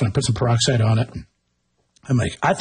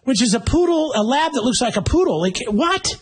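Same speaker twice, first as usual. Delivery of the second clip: incredulous; 10 semitones higher than usual. Real, not acted. Incredulous rant about labradoodles.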